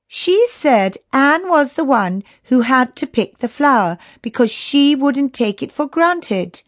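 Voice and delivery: put-on high, insistent child character; earnest young Chrissy. A narrator's reading. Chrissy reminds friends of instructions, high earnest voice. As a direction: remind them in a high girlish voice.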